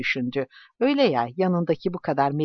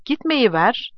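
raised, louder cry of protest; narrated shout; 8 semitones higher, 5 dB louder, slower than usual. A narrator's reading. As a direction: call it out higher and louder. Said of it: "diye bağırdı".

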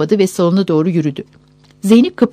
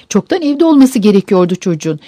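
ordinary delivery, nothing marked

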